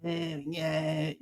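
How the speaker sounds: mock the anchor's lazy croak; mocking vocal fry. Mocking the CNN anchor's vocal fry, creaky and soft. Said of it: "the fried voice that, that, uh, C N N woman had".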